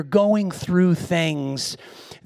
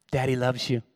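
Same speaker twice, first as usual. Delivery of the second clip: tender to daughter; 7 semitones lower, 7 dB quieter than usual. Retold or imitated. Father comforting daughter.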